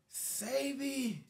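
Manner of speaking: a cured man's ecstatic high greeting; overjoyed man. Retold or imitated. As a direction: call out like a healed man overjoyed.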